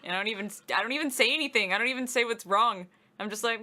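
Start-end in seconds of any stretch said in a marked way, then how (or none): none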